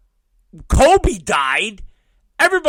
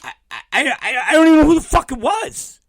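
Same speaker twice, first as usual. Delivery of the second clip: usual speaking voice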